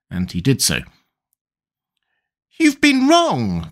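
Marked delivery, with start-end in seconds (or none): 2.6-3.7 high, slow and emphatic; Tweedledum's indignant high cry; cried out. Acted. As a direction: scold her like an indignant schoolboy. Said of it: "cried Tweedledum".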